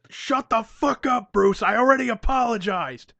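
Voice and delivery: high, loud comic tirade; hammed-up angry outburst; fed-up shouting. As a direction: yell back, fed up with being needled. Acted quarrel over an apology.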